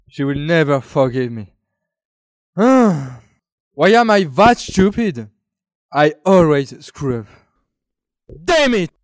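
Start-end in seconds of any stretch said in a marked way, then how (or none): 2.5-7.3 self-reproach. Acted. Eve blames herself after a breakup. 8.4-8.9 frustrated curse. Acted. Eve curses her own mistake.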